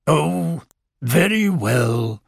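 slow, reluctant concession; grudging. Acted. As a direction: give in with a touch of sulk. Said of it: "said Owl a bit grudgingly".

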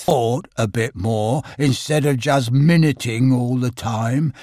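low, slow, mournful Eeyore drawl; gloomy donkey. Acted. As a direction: sigh it out like a glum old donkey. Eeyore speech.

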